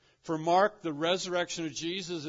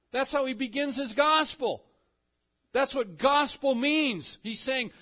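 loud, high, impassioned preacher; fervent raised-pitch sermon delivery; full-force preaching; 11 semitones higher, 9 dB louder than usual. Real, not acted. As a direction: drive the point home at the top of your voice.

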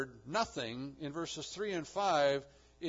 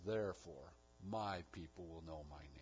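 low hushed declaration; 9 semitones lower, 7 dB quieter than usual. Acted. God's answer read again, low and soft.

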